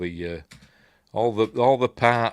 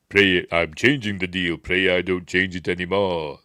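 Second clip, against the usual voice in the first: measured villain impression; put-on dark lord voice; Vader. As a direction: issue it as a stern film villain's threat. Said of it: "is Vader".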